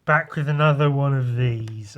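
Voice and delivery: loud, high, energetic vlog intro; hyped greeting. Real, not acted. Youtuber greeting his viewers, loud and hyped. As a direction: shout the channel hello.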